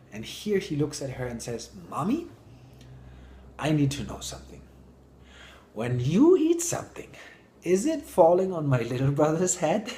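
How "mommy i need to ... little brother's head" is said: put-on higher, child-like asking, rising loud at the end; small child voice. The small boy asks his mom at dinner, in a higher curious child voice. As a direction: ask like a curious three-year-old boy.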